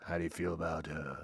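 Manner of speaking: low, hushed sleazy offer; soft, low-pitched seductive pitch; creepy flirting. Acted. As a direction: coax quietly like a creepy suitor. Imagined bald guy offering pepper.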